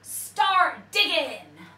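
loud, slow commanding order; pirate captain order. A narrator's reading. Pirate captain ordering the crew to dig, loud. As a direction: bark out the order. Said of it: "said Hank Chief".

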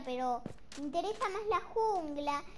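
usual speaking voice

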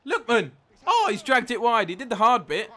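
loud, high cry at a near miss; real match-watching outburst; excited shout. Real, not acted. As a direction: shout it as the chance goes begging. Reacting to a missed chance.

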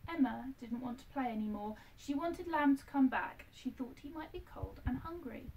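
ordinary delivery, nothing marked

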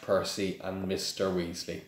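usual speaking voice

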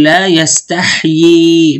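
يستحيي with both ya sounds, slowly; slow careful يستحيي with the second ya kept; keeping the second ya. Shown as a demonstration. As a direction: draw out the double ya in يستحيي at the stop. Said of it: "بجيب الياء الثانية".

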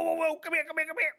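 ordinary talk, nothing marked

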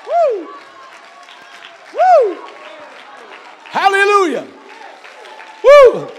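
high ecstatic preacher whoops; ecstatic woo. Real, not acted. Preacher whooping hallelujah to the congregation, ecstatic. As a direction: whoop it out in joy. Preacher exulting after climax.

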